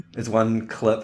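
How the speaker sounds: ordinary talk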